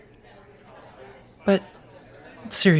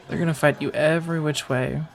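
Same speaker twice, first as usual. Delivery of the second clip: ordinary delivery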